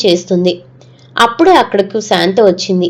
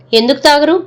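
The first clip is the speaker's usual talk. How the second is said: higher-pitched, mildly irritated challenge; curt irritation; 6 semitones higher than usual. A narrator's reading. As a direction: throw the question back with a touch of annoyance. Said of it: "విసురుగా అంటూ". (కొంచెం విసురుగా)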